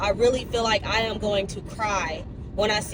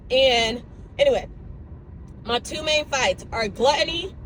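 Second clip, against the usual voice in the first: ordinary talk, nothing marked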